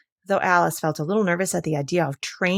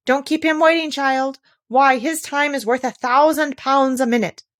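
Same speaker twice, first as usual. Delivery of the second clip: high, loud chattering crowd voice; high busy chorus of passengers; 7 semitones higher, 5 dB louder than usual. Acted.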